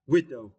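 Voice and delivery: widow said WI-dow, first syllable strong; stress on WI. Shown as a demonstration. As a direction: lean on wi in widow. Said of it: "you need to stress the first syllable".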